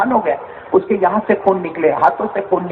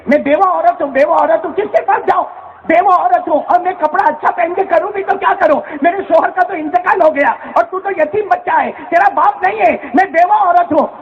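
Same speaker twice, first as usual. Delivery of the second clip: high, lamenting plea of a poor widow; a widow mother wailing to her boy, high and grieving; pleading mother; 8 semitones higher than usual. Acted. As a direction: cry out her helplessness to her son.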